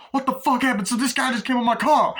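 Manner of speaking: high, loud shocked outburst; shocked yell. Retold or imitated. Cabbie shocked after body hits car.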